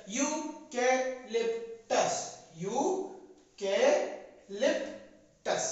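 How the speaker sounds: Eucalyptus very slowly, yu, ke, lip, tus; syllable-by-syllable drill. Shown as a demonstration. Teacher drills children on the spelling, very slow syllable-by-syllable eucalyptus. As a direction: pause after every syllable of eucalyptus. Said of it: "spelling कैसे याद करेंगे".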